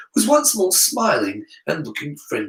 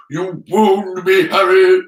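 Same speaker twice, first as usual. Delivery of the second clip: plain reading voice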